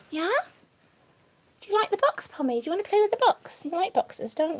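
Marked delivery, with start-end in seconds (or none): none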